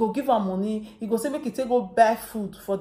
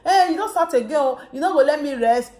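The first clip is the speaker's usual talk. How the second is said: loud, high, fed-up scolding; angry retort; 5 semitones higher, 7 dB louder than usual. Retold or imitated. Wife catches her ex-husband calling her name at midnight, loud angry retort. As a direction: snap back at him, exasperated.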